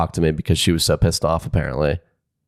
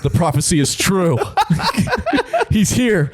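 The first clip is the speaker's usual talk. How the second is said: much higher, cracking up through a grand proclamation; laughing mock prophecy; 14 semitones higher than usual. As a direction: hail the chosen one while laughing. Mock proclaiming the chosen one.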